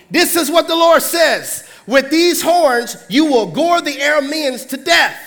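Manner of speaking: proclaiming prophet. Acted. False prophet Zedekiah loudly proclaiming to the kings. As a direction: announce it like a showy prophet.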